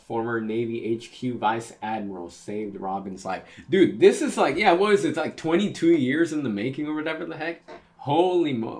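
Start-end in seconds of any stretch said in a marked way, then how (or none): 3.7-8.8 high, loud and fast; excited shout. Real, not acted. Fan overwhelmed as Robin meets Saul again, excited shouting. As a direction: babble in a rush, shouting with glee. Reader losing it over the reunion.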